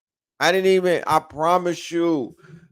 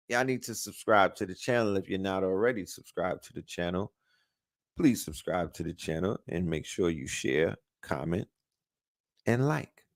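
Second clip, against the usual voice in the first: quiet fast plug; 7 semitones lower, 9 dB quieter than usual. Real, not acted. Quick subscribe plug.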